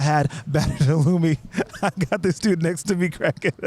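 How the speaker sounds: real laugh breaking through the words; laughing while talking. Real, not acted. Telling about the man cracking up beside him, laughing. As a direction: talk through a bubbling giggle.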